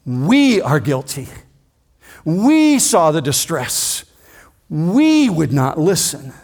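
slow, every We hit hard; emphatic confession. Retold or imitated. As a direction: own the guilt, word by word. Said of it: "So they say emphatically".

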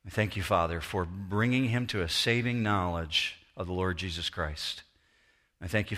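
low, subdued pastoral prayer. Real, not acted. Pastor praying for a grieving family, subdued prayer.

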